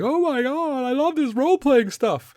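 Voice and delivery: very high, squealing mock enthusiasm; mock gushing. Recalling first time playing, high mock gushing. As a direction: gush like a thrilled geek. Mimics younger self gushing over game.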